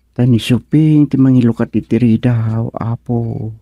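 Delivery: ordinary talk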